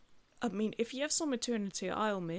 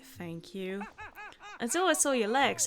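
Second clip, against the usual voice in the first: ordinary delivery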